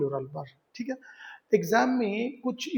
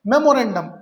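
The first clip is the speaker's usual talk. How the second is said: Memorandum said loud with a falling tune; headword called out; 6 dB louder than usual. Shown as a demonstration. Teacher loudly calls out memorandum as the next word. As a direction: announce memorandum to the class. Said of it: "teacher announces headword".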